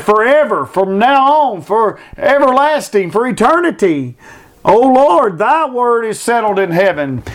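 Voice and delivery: fervent pulpit preaching; preaching at full force. Acted. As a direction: proclaim the verse to the congregation. Verse repeated in full preaching.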